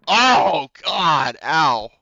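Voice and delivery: usual speaking voice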